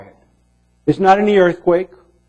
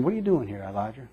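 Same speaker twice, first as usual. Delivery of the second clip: hushed, gentle question trailing off; small voice; 5 semitones lower, 11 dB quieter, faster than usual. Acted. God gently questions the hiding prophet, hushed. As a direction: ask it softly like a still small voice. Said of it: "it's like this small voice".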